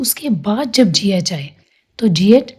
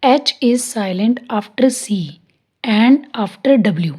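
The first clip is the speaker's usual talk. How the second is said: slow, clear rule dictation; teacher dictating slowly; slow dictated rule; much slower than usual. Shown as a demonstration. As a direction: read the rule slowly for notes. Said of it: "इसका rule है".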